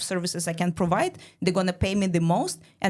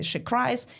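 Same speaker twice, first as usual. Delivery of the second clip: ordinary delivery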